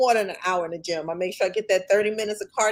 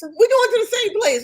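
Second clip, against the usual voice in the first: emphatic; 13 semitones higher, 5 dB louder than usual. Real, not acted. Repeating for emphasis, exasperated.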